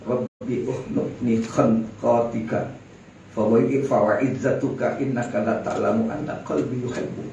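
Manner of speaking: low, slow devout recitation of a dying man's prayer; Arabic prayer recital. Retold or imitated. Preacher quoting Muaz bin Jabal's dying prayer, slow low recitation. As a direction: intone the Arabic slowly and low. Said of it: "dia katakan apa?".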